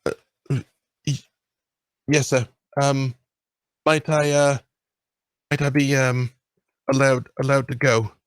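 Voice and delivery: put-on the boy hesitantly asks the lord if he may go; timid boy. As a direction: stammer like a nervous child asking permission. Shy boy asks to leave.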